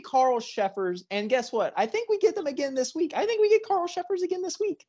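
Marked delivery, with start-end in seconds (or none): 1.8-4.8 excited. Real, not acted. Rising excitement about refs.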